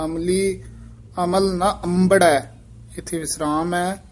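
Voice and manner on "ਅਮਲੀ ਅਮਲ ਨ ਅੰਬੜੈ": a short break after ਅਮਲੀ, then ਅਮਲ ਨ ਅੰਬੜੈ; pause and stress. Shown as a demonstration. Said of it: "ਇੱਥੇ ਵੀ ਯਮਕ ਲਾ ਦੇਣੀ ਹੈ".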